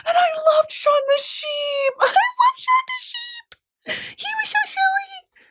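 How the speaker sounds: very high squeal of adoration; gushing. Real, not acted. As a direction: squeal over something adorable. Gushing over a cute cartoon sheep.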